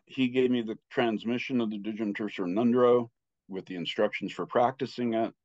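ordinary talk

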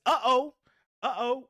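high, loud teasing uh-oh; excited sing-song. Real, not acted. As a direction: tease the donation in. Super sticker arrives.

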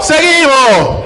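¿Seguimos? gritado y alargado, muy lento; drawn-out shout. Real, not acted. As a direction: estira ¿Seguimos? a todo pulmón. Host shouting question to crowd. (el presentador alarga la pregunta al público, gritando)

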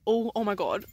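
ordinary delivery

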